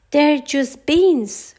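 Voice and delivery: high, loud, slow angry scoff; angry reply. A narrator's reading. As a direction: spit it out in disgust. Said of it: "replied Donald angrily".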